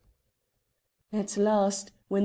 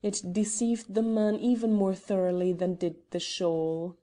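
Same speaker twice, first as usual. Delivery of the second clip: ordinary delivery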